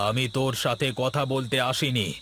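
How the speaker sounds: ordinary delivery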